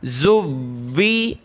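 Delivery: زوی slowed with the واو pressed hard; stressed doubled waw. Shown as a demonstration. Teacher drilling the doubled waw with pupils. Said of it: "واو کو سخت کریں گے شد کی وجہ سے".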